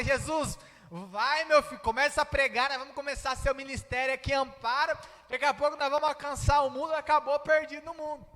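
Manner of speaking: high, loud, fast rush; eager urging. Acted. Believers pushing Jesus to start his ministry in Amparo, eager urging. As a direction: hustle them along excitedly, speeding up. Crente empolgado empurra Jesus a pregar.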